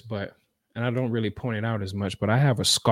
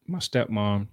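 normal talking voice

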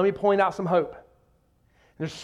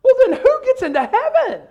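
very high, loud, baffled outcry; astonished squeal of a question; 8 dB louder than usual. Acted. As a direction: burst out in hammed-up disbelief. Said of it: "the disciples were astonished".

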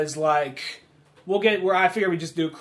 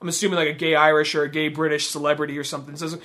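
ordinary delivery

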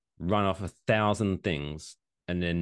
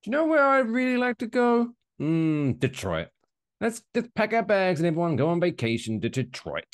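high put-on dreamy tourist voice; mock Dutch tourist; 9 semitones higher than usual. Mocking Amsterdam travellers, high dreamy Dutch tourist voice. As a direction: gush about Detroit like a starry-eyed visitor. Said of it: "anyone in the Netherlands going".

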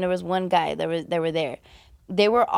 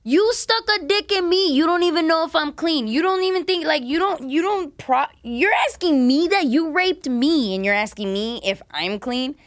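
much higher and louder, outraged; furious retort; 8 semitones higher, 5 dB louder than usual. Retold or imitated. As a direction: tear into him in disbelief. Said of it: "I'm like".

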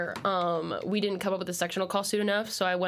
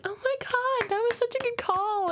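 much higher, gushing voice; gushing praise; 13 semitones higher than usual. Retold or imitated. Choir friend gushing over the call, high and excited. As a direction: gush like an impressed friend. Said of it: "Amelia was like".

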